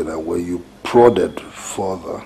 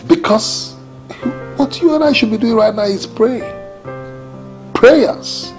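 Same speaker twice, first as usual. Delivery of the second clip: high, pressing insistence; urgent plea; 13 semitones higher than usual. Acted. Spouse urging prayer for Stephen, urgent plea. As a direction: urge prayer with the voice pitched high.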